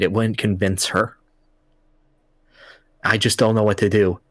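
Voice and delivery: low, halting voice of a husband with a dying wife; sorrowful old husband. Retold or imitated. Retiree explains his wife's terminal illness to the hero, low and sorrowful.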